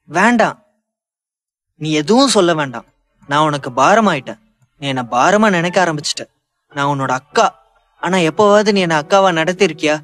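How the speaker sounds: loud, hurt, tearful outburst; crying out. A narrator's reading. Lilly telling her sister she feels like a burden, tearful outburst. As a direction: cry out the grievance, voice breaking high. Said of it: "சொல்லி அழுதாள்".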